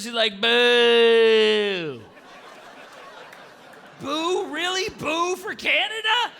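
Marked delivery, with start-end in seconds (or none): 0.4-2.0 retold heckler boo. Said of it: "this woman yells out".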